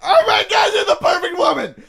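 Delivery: high, loud, ecstatic cry through laughter; hammed-up joyous yell; overjoyed shout. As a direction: shout it like you found true love. Ecstatic retold reaction, shouted.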